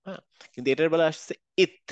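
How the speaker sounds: ordinary talk, nothing marked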